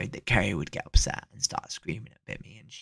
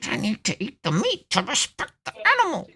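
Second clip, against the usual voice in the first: much higher, squeaky mock voice; mocking ranters; 13 semitones higher than usual. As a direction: parody the meat-eater's excuse in a silly high voice. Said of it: "When people go on rants about how".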